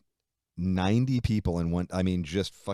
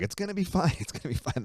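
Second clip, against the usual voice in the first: higher, sing-song mock soothing; mock reassurance; 10 semitones higher than usual. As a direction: lightly parody the boss calming him. Mimicking president's reassurance.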